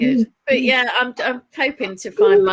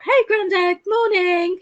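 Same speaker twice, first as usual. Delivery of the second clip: bright sing-out hello, pitched way up; cheery greeting; 13 semitones higher, 4 dB louder, slower than usual. Retold or imitated. Grandchild calling to Grandad on entering the flat, cheery. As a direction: call out a high, cheery morning greeting. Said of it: "all cheery like".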